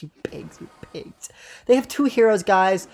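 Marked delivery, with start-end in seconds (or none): 0.0-1.3 drops from soft to a whisper; fading mutter. Real, not acted. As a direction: let the insult die away under your breath. Cursing opponents, fading out.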